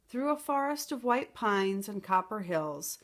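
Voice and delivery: ordinary delivery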